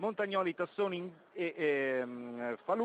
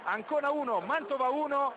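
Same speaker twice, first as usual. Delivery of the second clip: excited commentary; 6 semitones higher than usual. Real, not acted. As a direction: still buzzing from the goal. Recap of the goal, still elevated.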